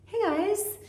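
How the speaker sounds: high-pitched friendly hello; chirpy greeting. Retold or imitated. Calling up her friends to sing, chirpy high greeting. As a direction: greet them in a high little voice.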